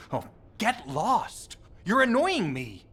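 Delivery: annoyed. Acted. Fed up with pestering grown-ups, snapping annoyed. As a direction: snap it off like you're fed up. Irritated character snapping at someone.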